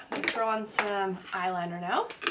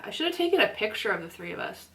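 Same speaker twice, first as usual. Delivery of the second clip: ordinary talk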